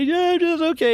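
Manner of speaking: squeaky-high retold reply from an upset date; high upset imitation. Date answering whether she is okay, mock upset.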